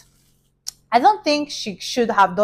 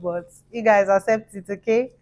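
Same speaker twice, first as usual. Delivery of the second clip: plain reading voice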